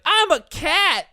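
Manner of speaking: put-on high, slow kid voice claiming to be a cat; little kid voice. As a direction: say it slowly, like a small kid pretending to be a cat.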